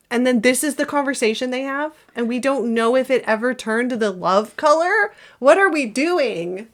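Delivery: loud, high, disbelieving outburst; exasperated rant. Real, not acted. Ranting about the mood ring.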